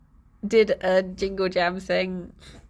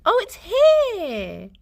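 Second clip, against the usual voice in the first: higher, louder, drawn-out "found it!"; delighted discovery; 11 semitones higher, 6 dB louder, much slower than usual. Real, not acted. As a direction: stretch it out, thrilled to find it. Finds the cat's hidden arm.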